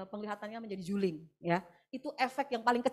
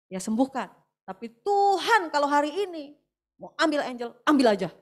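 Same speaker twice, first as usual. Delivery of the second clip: fervent prayer; 5 semitones higher, 11 dB louder than usual. Retold or imitated. Mother surrenders her sick daughter to God, loud fervent prayer. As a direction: cry it out as total surrender to God.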